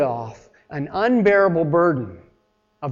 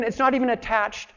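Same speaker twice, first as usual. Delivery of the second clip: ordinary talk, nothing marked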